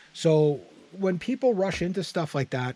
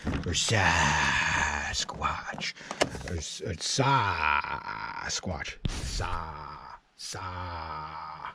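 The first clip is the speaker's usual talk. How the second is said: very low, very slow, drawn-out monster growl; 11 semitones lower, 8 dB quieter, much slower than usual. Acted. Vlogger hyping his biggest chainsaw, deep slow monster growl. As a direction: stretch the name into a deep beastly rumble. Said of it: "emphasis on the Sasquatch".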